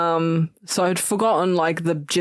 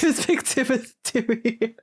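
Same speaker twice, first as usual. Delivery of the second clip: playful high voice; 6 semitones higher than usual. Acted. Playful name recall.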